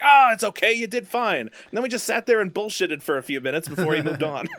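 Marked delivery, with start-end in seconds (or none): 0.0-1.4 reassuring celebrity. Retold or imitated. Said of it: "And he's like".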